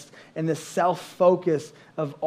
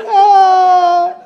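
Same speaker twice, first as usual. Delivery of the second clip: high, loud joyful coaster yell; roller coaster scream; 12 semitones higher, 9 dB louder than usual. Retold or imitated. Wife on the coaster, showing how to scream. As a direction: let out a whee of a scream. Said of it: "raise your hand like".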